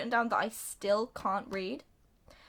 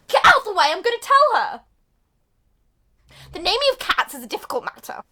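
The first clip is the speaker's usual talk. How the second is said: high, loud, pushy outburst; hammed-up announcement; 8 semitones higher, 13 dB louder, faster than usual. Munkustrap butts in to tell her, shouting. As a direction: shout it importantly, easing slightly. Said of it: "Monkey Strap's like".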